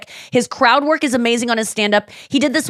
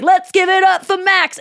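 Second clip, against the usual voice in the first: high-pitched imitation of a chanting crowd; crowd cheer; 9 semitones higher than usual. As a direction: echo it like a whole room cheering. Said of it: "the whole crowd is like".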